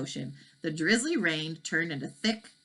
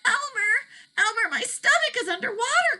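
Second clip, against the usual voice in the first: alarmed cry; 4 dB louder than usual. Acted. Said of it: "cried the dragon".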